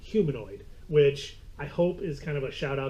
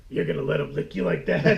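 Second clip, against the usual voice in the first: light cartoon-character impression, a bit louder; Howard the Duck; 2 semitones higher, 6 dB louder than usual. Co-host imitating an off-screen movie voice, light impression. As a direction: put on the duck's voice, playfully. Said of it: "you just hear this voice off in the distance".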